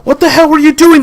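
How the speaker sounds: high, loud angry yell; furious shout. Acted. Captain to the shooter after the shot: furious high yell. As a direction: tear into him for firing. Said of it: "the captain said as he ripped".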